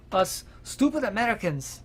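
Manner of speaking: a slight, botched attempt at a Japanese accent; failed Japanese accent. Podcaster mocking Americans in a bungled Japanese accent. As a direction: try a Japanese accent and miss. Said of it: "That was not a Japanese accent".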